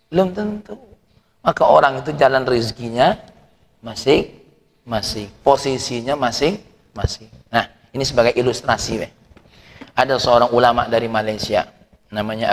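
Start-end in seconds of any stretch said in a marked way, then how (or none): none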